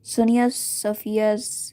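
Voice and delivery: usual speaking voice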